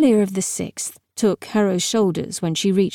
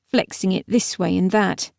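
ordinary delivery, nothing marked

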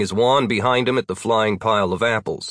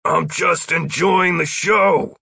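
slow, raised-pitch shout back; drawn-out shouted reply; 8 semitones higher, slower than usual. A narrator's reading. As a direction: call it back across a distance. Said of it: "Roy shouted back".

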